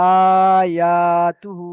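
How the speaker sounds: আয়াতুহু held long and slow; stretched recitation. Shown as a demonstration. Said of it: "তাহলে এখানে". (আয়াতুহু ধীরে টেনে তিলাওয়াত)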